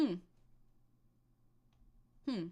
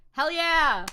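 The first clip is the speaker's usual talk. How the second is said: cheer; 5 semitones higher, 10 dB louder than usual. Real, not acted. Cheering own vote choice.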